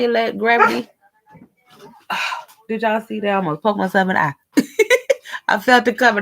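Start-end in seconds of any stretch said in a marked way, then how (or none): 4.6-5.4 sudden high squeal of laughter; startled laugh. Real, not acted. Almost poked eye.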